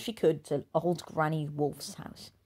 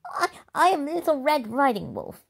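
higher, a touch of tearful stammer; holding back tears; 9 semitones higher, 6 dB louder than usual. Acted. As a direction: insist through held-back tears. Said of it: "fighting back the tears".

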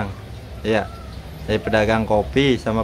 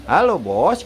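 "Halo, Bos" swooping up, down and up; sing-song call; 4 semitones higher, slower than usual. Shown as a demonstration. Owner coaching bird to greet the boss, sing-song.

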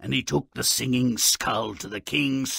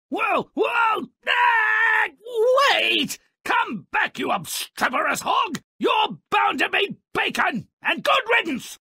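cartoon character yelling. Character shouting after the escaping boar, high hollering. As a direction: holler after a runaway hog in a squeaky voice.

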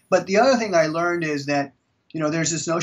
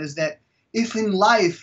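ordinary talk, nothing marked

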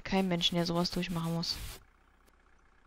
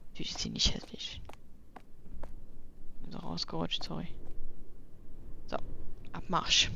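whispering; 2 semitones lower, 5 dB quieter than usual. Real, not acted. Whispered apology aside.

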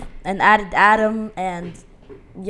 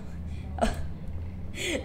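wheezing laugh; 4 semitones higher, 11 dB quieter than usual. Real, not acted. Laughing at edited message.

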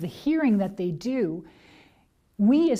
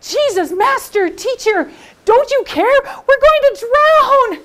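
much higher and louder panicked pleading, slow on drown; terrified cry; 13 dB louder than usual. Shown as a demonstration. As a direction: scream for help in fear. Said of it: "Have you ever been afraid".

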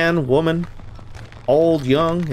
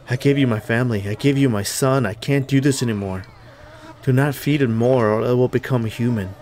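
lower, subdued confessional read; note reading; 4 semitones lower than usual. Acted. Reads a found note aloud.

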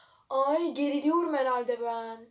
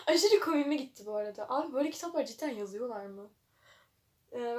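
normal talking voice

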